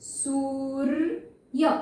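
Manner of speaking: सूर्य very slow, each sound apart; slow demo. Shown as a demonstration. Teacher slowly sounding सूर्य after writing it. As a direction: stretch सूर्य syllable by syllable. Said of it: "ऐसे ही लिखा जाएगा शब्द में".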